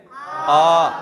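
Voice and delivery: आ called out loud and held long; loud, elongated आ; stretched long vowel. Shown as a demonstration. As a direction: pull the आ out loudly for the class. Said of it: "कैसे पढ़ेंगे?".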